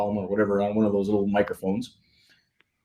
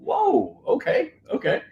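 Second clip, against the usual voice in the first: high-pitched retold amazement; astonished; 11 semitones higher than usual. Retelling his reaction to hearing Inter Miami, astonished.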